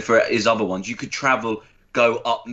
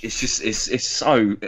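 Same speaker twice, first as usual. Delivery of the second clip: normal talking voice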